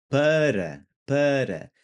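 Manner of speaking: Portuguese "Para", single quick tongue-tap R; tapped R. Shown as a demonstration. Teacher models the preposition, tapped-R "para". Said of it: "gonna have the r, r sound".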